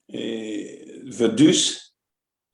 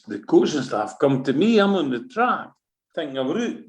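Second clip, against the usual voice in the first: usual speaking voice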